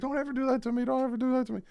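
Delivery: squeaky high mock complaint; imitating Tyreek. Player griping at his quarterback, squeaky high mock voice. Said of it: "And he was like".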